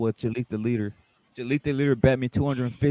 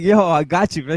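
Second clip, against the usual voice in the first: higher, louder mock-gleeful boast; happy boast; 4 semitones higher, 7 dB louder than usual. Bettor imitating his happy rival taking the bet, happy boast. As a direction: imitate him gloating happily. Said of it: "he was happy, bro".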